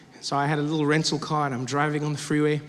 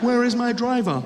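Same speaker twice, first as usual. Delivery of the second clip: lightly put-on waiting-for-my-driver act, pitched higher; feigned impatience; 5 semitones higher than usual. Comedian pretends he planned it all, mock impatience.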